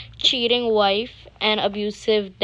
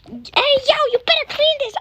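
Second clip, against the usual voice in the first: shrill furious yell, much higher and louder; screaming dad; 6 dB louder than usual. Acted. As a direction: scream it down at her like an angry bully. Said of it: "screams at her".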